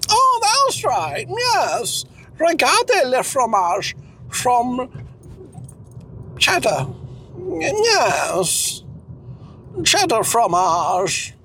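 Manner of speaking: high-pitched, drawn-out struggle for French words; Cecil, fussy would-be French speaker. Acted. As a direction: grope for French in a high, slow character voice. Plays Cecil.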